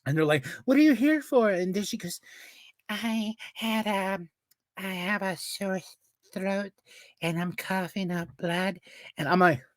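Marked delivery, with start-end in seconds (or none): 0.6-1.5 high-pitched mock intake question; chirpy mimicked questioner; imitated hospital staffer. 2.9-8.8 high, soft, slow whiny imitation; put-on weak little voice; mocking feeble patient. Mocking girl's feeble complaint.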